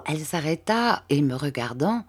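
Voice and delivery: ordinary delivery, nothing marked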